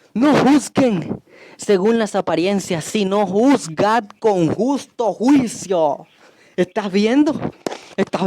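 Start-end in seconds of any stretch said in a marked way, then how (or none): 0.1-6.1 very slow, solemn verse reading; scripture reading. Real, not acted. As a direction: draw out each word of the verse. Reads out the Bible verse. (lectura bíblica lenta y solemne)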